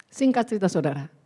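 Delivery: usual speaking voice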